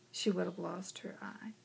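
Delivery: unvoiced hushed gravity; whispered verdict. Eye doctor tells mother the danger, whispered. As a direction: drop to a grave whisper.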